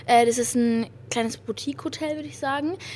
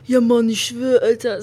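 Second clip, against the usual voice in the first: mock cool guy; 7 dB louder than usual. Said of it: "So, so gehen die durch die Stadt".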